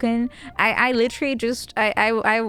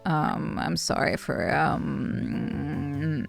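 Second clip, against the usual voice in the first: low, rough just-woke-up voice; groggy morning voice; 7 semitones lower, 6 dB quieter, slower than usual. Retold or imitated. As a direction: sound like you rolled out of bed. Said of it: "hide your morning voice".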